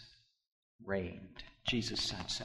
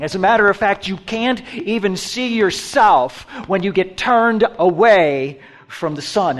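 louder, higher, full-force sermon; preaching; 7 semitones higher, 14 dB louder than usual. Acted. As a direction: drive it home to the pews.

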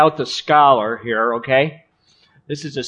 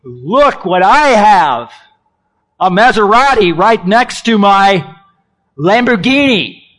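flaunt it like a rich show-off; swaggering mock brag; 3 semitones higher, 5 dB louder than usual. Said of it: "like, showing himself to be".

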